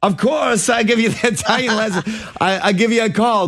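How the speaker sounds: hammed-up Italian accent, pitched high; horrible Italian accent. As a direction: do a bad, high-voiced Italian suitor. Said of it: "the horrible Italian one too".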